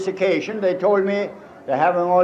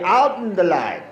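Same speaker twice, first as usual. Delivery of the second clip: right phrasing. Shown as a demonstration. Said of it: "You should say".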